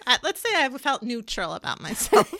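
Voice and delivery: amused, with laughter breaking in; laughing. Real, not acted. Co-host settles a teasing dispute with her friend, laughing. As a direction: concede the point through a laugh.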